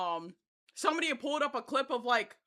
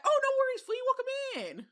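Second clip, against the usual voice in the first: imitating a chatter; 13 semitones higher than usual. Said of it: "when you were like".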